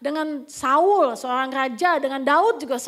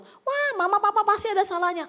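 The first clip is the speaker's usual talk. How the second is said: higher-pitched mock accusation; mock accusing child; 8 semitones higher, 4 dB louder than usual. Children pinning blame on parents, mock accusing. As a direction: imitate a child cornering the parents. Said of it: "jangan memojokkan orang tua".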